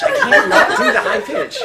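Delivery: squeaky high Irish voice; can't help going high; high pitch. Acted. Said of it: "I do the high pitch".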